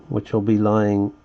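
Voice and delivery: ordinary talk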